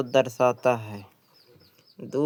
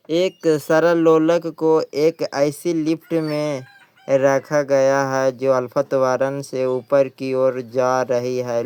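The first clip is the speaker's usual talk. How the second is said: question read aloud; 5 dB louder than usual. Acted. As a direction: read the question out clearly for the class. Reads exam question aloud. (प्रश्न को धीरे और ज़ोर से पढ़ना)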